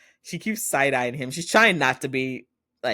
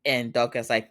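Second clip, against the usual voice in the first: normal talking voice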